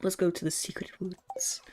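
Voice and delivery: conspiratorial whisper; playful whisper. Pretend. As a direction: whisper it like a secret plan. Whispered proposal, then announced aloud.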